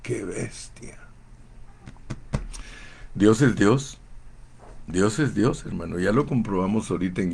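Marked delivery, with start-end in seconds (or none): 0.0-1.0 softer, slow Ecuadorian qué bestia; Ecuadorian exclamation. Retold or imitated. Preacher imitating how Ecuadorians say qué bestia. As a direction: marvel quietly the Ecuadorian way. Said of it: "En Ecuador dicen".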